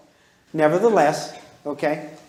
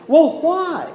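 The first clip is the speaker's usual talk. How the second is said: put-on kid's why; 9 semitones higher, 4 dB louder than usual. Kid demanding.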